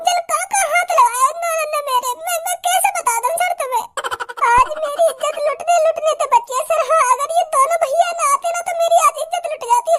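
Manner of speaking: high falsetto, half crying; a hammed-up tearful girl accusing the boys; man voicing a weeping girl; wronged girl sobbing.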